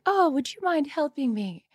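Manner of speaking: high, soft helpless damsel voice; damsel in distress. Retold or imitated. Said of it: "I act like a damsel in distress".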